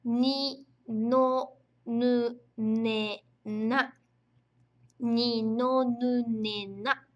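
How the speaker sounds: ねのになぬ very slow, each syllable apart; teacher sounding out the kana row slowly; slow reading drill. Shown as a demonstration. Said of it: "número três".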